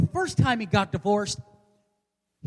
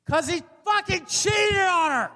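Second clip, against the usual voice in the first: outraged shout; 8 semitones higher than usual. Acted. Comedian answering why the nurse is divorcing, outraged shout. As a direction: holler the punchline in outrage. Answers own question with outburst.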